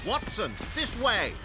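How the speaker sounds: high urgent call ahead to a friend; urgent shout over the noise; calling out urgently. Acted. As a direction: hurry him along, calling back. Holmes urging Watson to follow.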